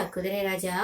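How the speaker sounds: soft, low teasing echo; playful teasing. A narrator's reading. Princess's friend coaxes the horse, low playful echo. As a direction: drop the repeated call down low and soft. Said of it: "வேடிக்கையாக".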